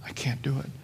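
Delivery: breathy whisper, dropping to nothing; a soft, defeated whisper; whispered refusal. Retold or imitated. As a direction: whisper it like a quiet giving up. People who walk away from Jesus.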